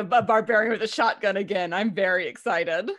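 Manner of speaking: usual speaking voice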